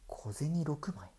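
hushed, slow whisper. Acted. Priest whispering the eerie detail to the shopkeeper. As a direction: breathe the words out, slowly and eerily. Priest reveals the six coins.

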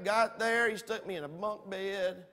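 ordinary talk

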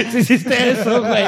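high-pitched teasing laugh. Real, not acted. Teasing friend after wrong answer. (riéndose con burla)

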